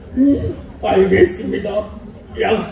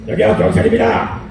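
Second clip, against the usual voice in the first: calling out; 5 semitones lower than usual. Acted. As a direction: shout 여기요 at the gate like a cop. Police calling at the gate.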